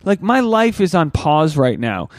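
high, slow exasperated whine. Retold or imitated. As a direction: whine it, pleading for peace. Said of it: "And I was like".

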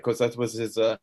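usual speaking voice

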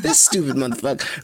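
usual speaking voice

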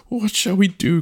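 high, fretful wail; distressed cry. Acted. Chief at a loss over two suitors, crying out. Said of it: "cried the chief".

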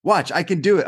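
high-pitched mock insistence; insistent mom. Mom telling neighbour she can manage, high and insistent. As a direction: imitate a frantic mother waving off objections.

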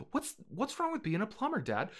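high-pitched little whine; pleading son. Acted. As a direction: whine your protest at Dad. Acted son pleading with father.

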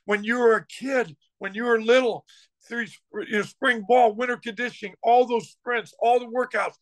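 pitched high, riding the excitement; fired-up pep talk. Retold or imitated. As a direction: pump the players up before kickoff. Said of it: "I was so fired up".

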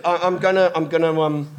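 ordinary talk, nothing marked

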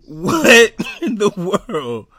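high, loud, drawn-out exclamation; baffled, cracking up; laughing disbelief. Real, not acted. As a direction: stretch it out through laughter.

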